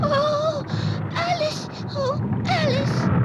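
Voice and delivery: frightened. Acted. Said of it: "as though you've seen a ghost".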